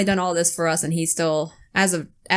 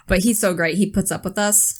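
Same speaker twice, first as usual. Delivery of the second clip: usual speaking voice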